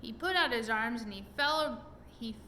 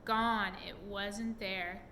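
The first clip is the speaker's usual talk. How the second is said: plain reading voice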